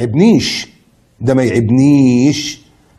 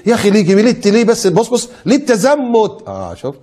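lightly mocking, higher voice of a critic; 6 semitones higher than usual. Preacher mimics people mocking his strictness. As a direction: imitate a scoffer who finds faith too strict. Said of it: "بعض الناس تقول لك ايه".

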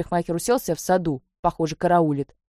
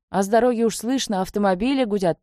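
ordinary delivery

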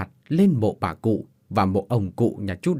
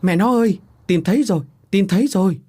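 gọi to, cao hẳn lên, vui mừng; hét gọi vợ khi tìm thấy cái răng; kêu lên mừng rỡ; 7 semitones higher, 5 dB louder than usual. Acted. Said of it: "Chú mới kêu lên". (chồng gọi vợ vì đã tìm thấy răng, kêu to mừng rỡ)

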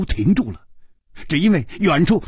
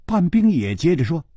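plain reading voice

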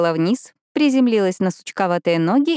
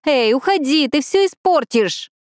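shouting; 8 semitones higher than usual. Acted. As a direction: shoo it away desperately. Pippa shouts at the magpie.

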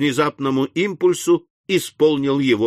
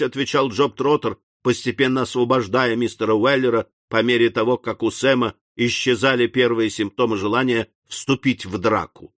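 usual speaking voice

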